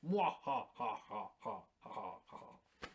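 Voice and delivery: spaced-out, hammed-up evil "mwah ha ha"; mock evil laugh. Joking as invader plotting to take Ukraine, mock evil laugh. Plays villain gloating over Ukraine.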